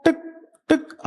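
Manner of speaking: short, separate ticks with gaps; imitated watch ticks. Shown as a demonstration. Teacher helping a child name the watch sound, imitated ticking. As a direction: tick like a watch, evenly spaced.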